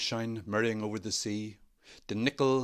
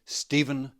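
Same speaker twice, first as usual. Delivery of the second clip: plain reading voice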